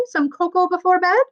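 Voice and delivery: high, sing-song Irish brogue; cheerful Irish steward offering towels; jolly Irish accent. Acted. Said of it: "Irish accent ... like a jolly song".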